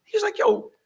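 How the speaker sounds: mock high-pitched greeting; excited yo. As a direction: squeal the yo high. Gang cop reassuring the host.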